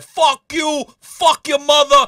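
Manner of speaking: hammed-up New York tirade; going wild. Spy going wild at his British captors, in a loud mock rant. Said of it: "he just probably went wild".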